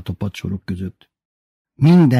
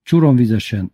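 plain reading voice

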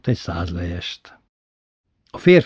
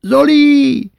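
very high, loud panicked scream; desperate scream; 5 dB louder than usual. A narrator's reading. Little brother caught by the wrist screaming to Zoli, panicked high shriek. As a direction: shriek for your brother in terror. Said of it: "ordított".

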